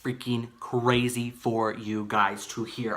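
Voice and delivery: slow, drawn-out emphasis; talking slow. Real, not acted. Vlogger building up a crazy story for viewers, slow. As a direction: stretch the words out slowly. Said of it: "I don't know why I'm talking so slow".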